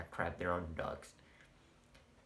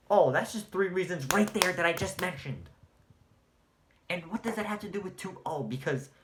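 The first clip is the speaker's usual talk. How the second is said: higher and louder, sarcastic rant; heated shout; 8 semitones higher, 6 dB louder than usual. Real, not acted. Ranting at Laker fans, heated sarcastic shout. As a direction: push the sarcasm hard.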